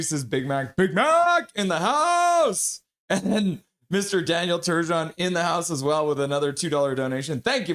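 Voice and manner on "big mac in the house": slow, high, drawn-out hype call; announcer welcome. Pretend. Streamer announcing a chat regular's arrival, hype announcer. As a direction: stretch it like a ring announcer.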